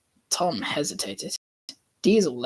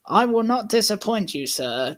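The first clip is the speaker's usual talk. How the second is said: proud; 6 semitones higher than usual. A narrator's reading. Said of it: "Tom whistled proudly".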